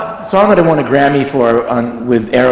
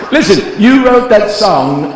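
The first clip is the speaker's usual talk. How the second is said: higher, slow mock [name] voice; [name] impression; 8 semitones higher, slower than usual. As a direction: drag it out like [name] praising you. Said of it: "And he goes".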